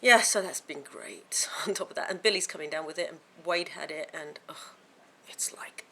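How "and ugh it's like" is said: soft tired whisper; weary whisper. Real, not acted. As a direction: trail off into a whisper. Weary whisper about illness.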